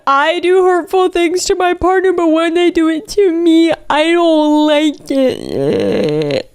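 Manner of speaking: high, drawn-out imitation of a whiner; whiny mockery. Reader parodying hypocritical prank husband, whiny mockery. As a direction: whine it slowly in a put-on high voice.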